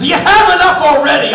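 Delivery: high, very loud comic outburst; exasperated shout. Acted. Comedian yells back at a magazine headline, exasperated. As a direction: explode in mock outrage.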